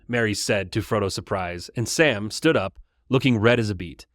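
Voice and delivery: ordinary talk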